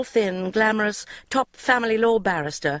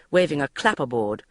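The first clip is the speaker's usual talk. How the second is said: usual speaking voice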